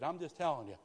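usual speaking voice

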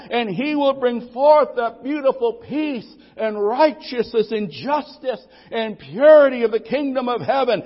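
loud, high, ringing preacher's cry building to shouts; full-force preaching. Acted. Preacher proclaiming the coming kingdom to the church, loud and ringing. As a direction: thunder it out to the congregation.

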